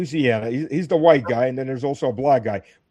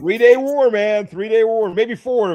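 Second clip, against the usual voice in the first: louder, higher mock confidence; mock bravado; 6 semitones higher, 6 dB louder, slower than usual. Mocking the hawks' quick-war promise, loud sarcasm. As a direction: sell the short war like a boast.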